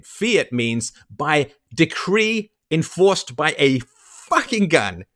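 normal talking voice

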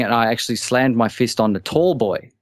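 usual speaking voice

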